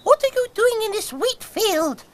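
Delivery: very high squeaky mice; tiny calling mice; field mice. Acted. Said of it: "called four field mice".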